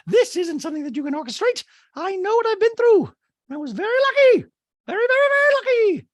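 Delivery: very high, loud mock-indignant voice; hammed-up squeaky impersonation; Dorit. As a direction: caricature her, piling on the emphasis. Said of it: "And Dorit's like".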